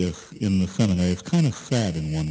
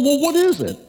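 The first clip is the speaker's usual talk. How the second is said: much higher, louder, pressing demand; finally losing patience with him; exasperated priest; 5 dB louder than usual. Acted. Priest demanding answers from the monk, exasperated and loud. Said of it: "the priest is really upset".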